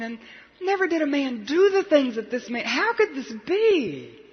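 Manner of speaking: high, drawn-out disbelief, falling away at each question's end; bewildered wonder. Acted. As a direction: wonder aloud in anguished amazement, pitch lifted. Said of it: "stop for a moment and wonder".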